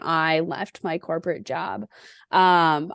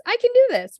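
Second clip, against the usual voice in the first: "I can do this" pushed much higher and louder, all in; stronger self pep talk; 5 dB louder, faster than usual. Retold or imitated. Leaving work, she hypes herself up even harder, much higher. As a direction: shout it to convince yourself.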